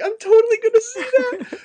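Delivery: very high, loud fanboy squeal; giddy excitement. Retold or imitated. Host retells caving to the trailer, high excited squeal. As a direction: burst out in hammed-up high excitement. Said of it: "made me so excited to see it".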